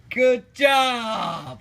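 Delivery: bright, loud high cheer; excited praise. Real, not acted.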